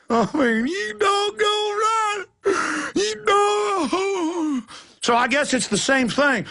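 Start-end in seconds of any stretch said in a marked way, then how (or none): 0.7-4.6 very high, drawn-out panicked wail; mock wailing. Imitating hysterical police officer, mock high wail. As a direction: wail it out slowly, hammed up.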